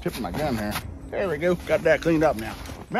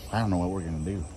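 ordinary talk

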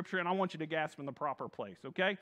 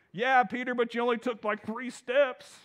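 mocking apostle; 5 semitones higher, 5 dB louder than usual. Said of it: "I can just hear one of the other apostles".

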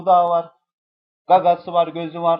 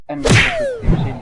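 mock squeaky voice; 9 dB louder than usual. Sudden odd voice mid-review.

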